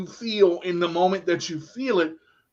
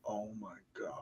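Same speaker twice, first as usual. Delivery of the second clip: very low, barely audible murmur; softly stunned, far quieter; stunned realisation; 8 semitones lower, 20 dB quieter than usual. Retold or imitated. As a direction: let it out under the breath, deep and hushed. Retelling his stunned realisation.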